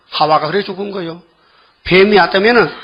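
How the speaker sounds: ordinary talk, nothing marked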